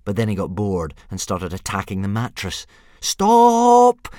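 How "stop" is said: high, full-out drawn yell. A narrator's reading. Boy stops dragon attacking the mattress, high loud yell. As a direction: yell to halt the chaos. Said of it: "yelled Hiccup".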